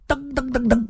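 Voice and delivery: quick, high, soft dun-dun-dun; running footsteps imitation. A narrator's reading. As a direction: patter out the footsteps in staccato syllables. (模仿噔噔噔的跑步声)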